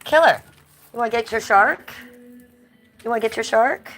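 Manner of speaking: high, loud pet talk, rising on "shark"; sing-song call to coax the dog into playing. Real, not acted. Calling the dog to play.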